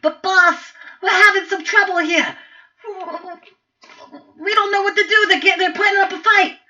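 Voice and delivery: high, loud pleading minion voice; nervous goblin minion. Acted. Goblin minion reporting trouble to his boss, high loud pleading. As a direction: plead with the boss that it's going badly. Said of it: "one of the Grenell turns to him and says".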